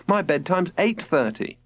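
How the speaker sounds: a kid objecting, lightly voiced; protesting boy. A narrator's reading. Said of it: "protested Robert".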